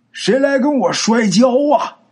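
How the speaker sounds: high-pitched, drawn-out hollow monster challenge; booming, muffled voice. A narrator's reading. Mysterious voice challenges a lone traveler to wrestle, booming. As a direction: call out in a thick, muffled monster voice. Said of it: "有这么一瓮声瓮气的声音呢".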